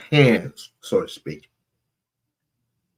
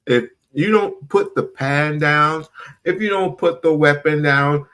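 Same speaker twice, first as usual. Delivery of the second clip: ordinary talk, nothing marked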